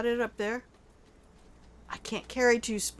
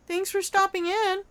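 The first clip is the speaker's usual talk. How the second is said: sarcastic thanks; 12 semitones higher than usual. Pretend. Thanking a raider.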